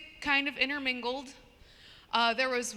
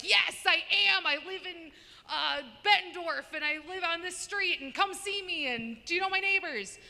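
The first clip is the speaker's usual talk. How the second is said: higher, overly eager Midwest local; eager Midwesterner; 7 semitones higher than usual. Retold or imitated. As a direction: gush like a friendly neighbor. Mocking friendly Midwesterners.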